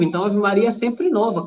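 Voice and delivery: ordinary talk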